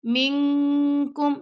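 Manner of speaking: منکم with the noon hidden and nasal before ک; منکم, noon hidden into kaf; ikhfa on noon. Shown as a demonstration. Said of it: "یہاں پہ ہم نے اخفا کرنا ہے".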